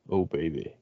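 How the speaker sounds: low, soft mock sultry murmur; sultry narrator. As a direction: purr it like a mock seduction. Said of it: "Read it in a sexy voice".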